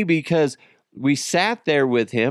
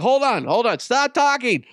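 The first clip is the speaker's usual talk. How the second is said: high, urgent cut-in; high-pitched frantic halt; mock panic; 9 semitones higher than usual. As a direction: shut the kid up in a hammed-up fluster. Retold panicked interruption of son.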